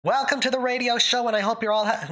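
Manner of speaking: put-on a touch of old radio announcer; mid-Atlantic accent. Shown as a demonstration. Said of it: "talk in a mid-Atlantic accent".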